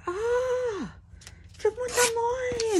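very high, slow delighted shopkeeper voice; imitating delighted grocer. Grocer answering her New Year greeting, imitated very high. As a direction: copy the grocer lighting up. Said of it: "and she go".

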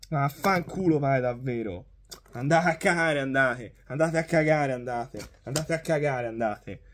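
low, soft disgusted muttering; muttered cursing. Real, not acted. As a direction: curse under your breath, fading. Repeated curses fading to mutter.

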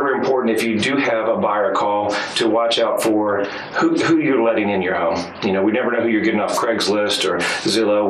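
normal talking voice

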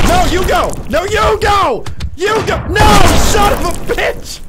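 high, loud insistent shouts; exasperated, escalating yell; heated yelling. Real, not acted. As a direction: shout them into going first. Mock standoff shouted at player.

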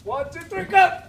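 loud rising count; shouted count-off. Real, not acted. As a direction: bark out the lift count. Count-off for lifting a beam.

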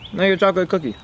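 ordinary talk, nothing marked

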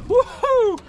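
high, loud whoop; real excited cheer; excited woohoo. Real, not acted. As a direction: whoop for the big fish. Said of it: "As you can tell, I'm excited".

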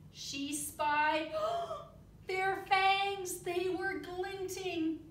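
lively read-aloud with big character voices; storybook reading. Acted. Teacher reading a bug story to the children, animated. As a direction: read it like a picture book for the class.

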